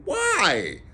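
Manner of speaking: high, insistent appeal; crying out. A narrator's reading. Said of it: "cried Scrooge's nephew".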